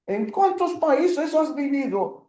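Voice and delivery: a friendly stranger asking where you've lived; teacher playing someone asking where you've lived; asking a new acquaintance. Shown as a demonstration. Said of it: "it's a common thing to say".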